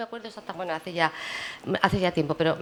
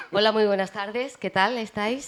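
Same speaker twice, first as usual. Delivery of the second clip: radio voice; 3 semitones higher, 3 dB louder than usual. Shown as a demonstration. Colleague performs her radio voice on request. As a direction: greet listeners like a radio host. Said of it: "Eso es radio".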